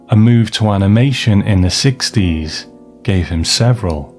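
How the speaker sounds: ordinary talk, nothing marked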